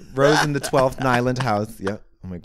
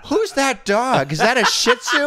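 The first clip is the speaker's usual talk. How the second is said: squeaky mock gasp; 12 semitones higher, 5 dB louder than usual. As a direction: pitch way up and gawk through a laugh. Teasing mock reaction voice.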